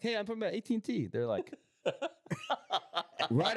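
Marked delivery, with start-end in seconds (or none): 0.0-1.1 cheery mock greeting. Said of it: "Funny, 'cause I'm like".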